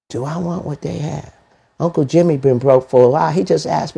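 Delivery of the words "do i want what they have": much softer inner question; quiet self-question. Shown as a demonstration. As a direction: ask it quietly to yourself. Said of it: "practice this technique".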